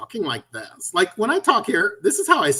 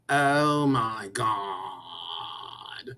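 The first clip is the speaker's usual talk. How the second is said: slow drawn-out affected drawl, a little lower; 7 semitones lower, 6 dB quieter, much slower than usual. Retold or imitated. Mocking the TikTok bit with a slow, affected drawl. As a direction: stretch it like a faded old-movie dandy. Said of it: "when we hear the bit".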